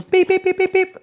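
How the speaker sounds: high mimicked beeping; imitating a scanner alarm; scanner beeps. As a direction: beep like a metal detector.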